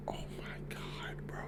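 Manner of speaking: whispered amazement; hushed awe; awed whisper. Real, not acted. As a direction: breathe it out, overwhelmed. Overwhelmed by the finale.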